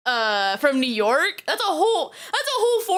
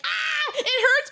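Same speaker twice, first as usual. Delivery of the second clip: high, slow, hammed-up whimper of pain; mock pained cry; 12 semitones higher, slower than usual. Mocking the psychic boy who screams in the clip, hammed-up pained whine. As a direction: whine it out like a hurt kid. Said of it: "He's like".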